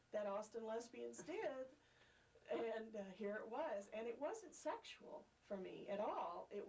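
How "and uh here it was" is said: normal talking voice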